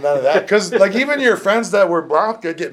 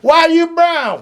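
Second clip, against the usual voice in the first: mock Southern challenge; 12 semitones higher, 6 dB louder than usual.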